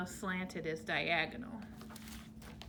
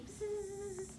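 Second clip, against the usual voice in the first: high soft buzzing; bee buzz; 10 semitones higher, 9 dB quieter than usual. Shown as a demonstration. Teacher to class, a high, soft bee buzz. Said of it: "like a little bumblebee".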